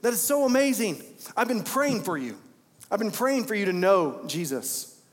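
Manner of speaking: overjoyed friend hearing the news; high, sing-song excited congratulations; thrilled coworker. Retold or imitated. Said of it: "And he goes".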